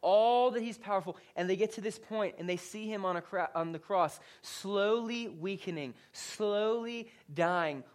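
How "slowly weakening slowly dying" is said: very slow, stretched words; drawn-out emphasis. Real, not acted. Preacher pictures Jesus on the cross, slow and drawn-out. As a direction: drag out each word for dramatic weight.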